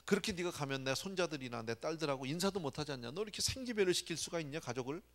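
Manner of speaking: blaming complaint. Retold or imitated. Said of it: "막 이러면서 이제 많이 블레임을 합니다".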